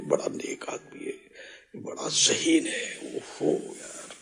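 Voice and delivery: slow hushed whisper. Retold or imitated. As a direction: whisper it like secret gossip. People whispering praise about someone.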